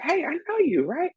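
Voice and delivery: put-on high bright hello to an old friend; excited greeting. Spotting a friend from New York, high excited greeting. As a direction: lift up, thrilled to spot him.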